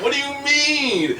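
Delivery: high, drawn-out mock bewilderment; laughing disbelief. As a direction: stretch it out in baffled laughter.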